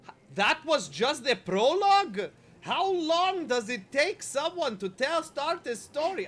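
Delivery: high, loud, slow mock of the outraged character; mocking the game character. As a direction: parrot her indignant whine, stretched out. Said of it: "absolutely hysterical".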